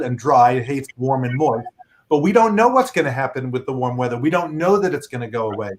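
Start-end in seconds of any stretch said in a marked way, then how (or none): none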